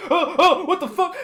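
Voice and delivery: high, loud panicked cry; startled yelp. Acted. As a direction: jump in shock at a spider. Enemy startled by spider.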